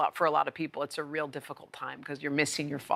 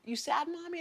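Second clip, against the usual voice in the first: put-on a very high, small child's voice, a bit softer; little girl's question; 12 semitones higher, 4 dB quieter than usual. Oldest daughter asking her crying mom, very high child voice. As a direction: ask it like a toddler worried about mommy.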